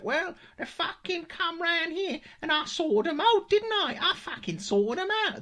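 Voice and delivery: put-on high, loud, drawn-out seventies street-kid accent; slow, strident mock seventies accent; seventies kid's accent. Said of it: "It's a very seventies accent".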